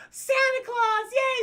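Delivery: put-on very high, loud squeal of excited children; kids cheering. Children spotting Santa in the chimney, squealing. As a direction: squeal like a delighted little kid.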